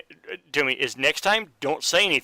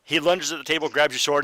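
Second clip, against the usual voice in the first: ordinary delivery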